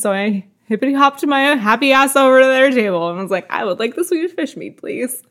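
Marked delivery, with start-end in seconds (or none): 3.5-5.2 higher, softer voice, mock-meek request; retold polite order. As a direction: imitate yourself ordering sheepishly, small and high.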